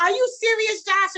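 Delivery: retelling her thrilled, stunned question; excited disbelief. Retold or imitated. Retold stunned reply to Josh.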